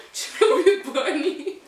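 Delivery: laughing with a mouth full; giggling through stuffed cheeks. Real, not acted. More marshmallows in mouth, laughing.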